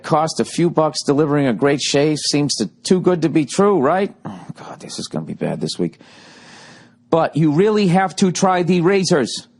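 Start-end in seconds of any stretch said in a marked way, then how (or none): 4.3-6.0 whispered dread under the breath; a whispered aside to yourself. Real, not acted. 7.1-9.5 upbeat, higher-pitched ad read. Acted. Podcast ad read.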